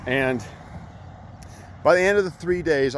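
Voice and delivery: ordinary talk, nothing marked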